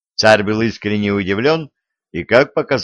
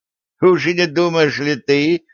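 usual speaking voice

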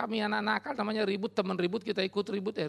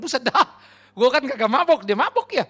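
put-on very loud, higher-pitched drunken protest; drunk friend; 5 semitones higher, 12 dB louder than usual. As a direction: protest loudly like a drunk in denial. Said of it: "Kawan saya nomor satu mabuk".

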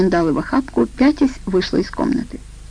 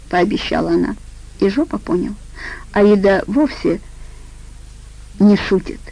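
ordinary delivery

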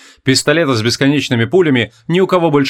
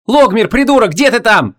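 yelling; 9 semitones higher than usual. A narrator's reading. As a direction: yell for a friend. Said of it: "заорала Вон".

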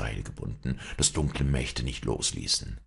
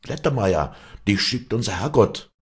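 higher, louder glad cries; crowd calling out; 6 semitones higher, 5 dB louder than usual. Acted. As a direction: hail the rescuer together. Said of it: "riefen die Leute durcheinander". (freudiges lautes Rufen)

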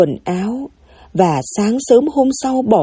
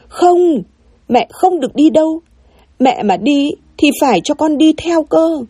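put-on higher-pitched child refusing to let mum go; child's balking protest; 7 semitones higher than usual. A narrator's reading. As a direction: protest like a clingy little kid. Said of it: "Thằng Mùi bỗng dãy nảy lên". (giọng trẻ con giãy nảy, nũng nịu phản đối)

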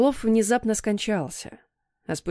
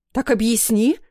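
louder, sharply rising challenge; exasperated demand; 3 semitones higher, 5 dB louder than usual. A narrator's reading. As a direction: demand an explanation, voice jumping up. Mother confronts pacing father. (требовательно и громко, с вызовом)

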